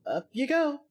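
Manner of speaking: put-on young girl cheering as help arrives; excited girl. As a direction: burst out with delight at the rescue. Excited girl spots flashlight.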